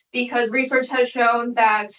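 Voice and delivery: ordinary talk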